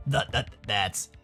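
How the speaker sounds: low, soft, slow stutter; cowed, halting mumble; nervous stammer. Acted. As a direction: stumble timidly over the words. Roswell cornered by snarling Tyson.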